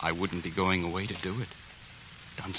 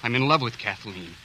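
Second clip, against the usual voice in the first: bold declaration; 3 semitones higher, 10 dB louder than usual. Acted. Suitor defies the angry father.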